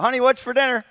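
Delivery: calls out loud and high across the house; hollering. Retold or imitated. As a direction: holler to a wife who seems deaf. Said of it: "and hollers out".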